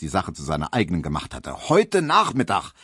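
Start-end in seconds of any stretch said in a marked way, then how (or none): 1.7-2.7 high, emphatic declaration; worked-up agitation. Acted. Barber planning colleague's appeal, worked up. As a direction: push the plan with fighting zeal. Barber rants on about the case.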